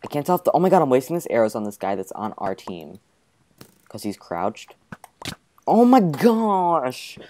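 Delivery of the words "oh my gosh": very slow, loud, high exclamation; long startled oh my gosh; drawn-out surprise. Real, not acted. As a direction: stretch every word in shock. Startled exclamation mid-game.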